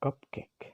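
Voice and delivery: cupcake with even weight on cup and cake; cupcake, two evenly stressed beats; equal stress. Shown as a demonstration. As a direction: give both syllables of cupcake the same stress. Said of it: "equal stress for both syllables".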